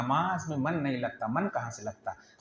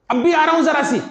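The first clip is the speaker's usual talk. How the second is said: distracted hurrying youngster; 11 semitones higher, 7 dB louder, faster than usual. Child hurrying, phone in hand.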